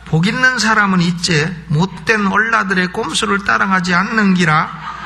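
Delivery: comic scripture reading in Gyeongsang dialect. Shown as a demonstration. Preacher giving the congregation a regional psalm, Gyeongsang dialect. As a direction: recite the psalm like a Gyeongsang local. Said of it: "경상도 버전도 있어요".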